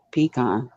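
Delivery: "pecan" as pee-KAHN; careful pee-KAHN for "pecan"; pronunciation attempt. Shown as a demonstration. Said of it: "How does everybody say pecan?".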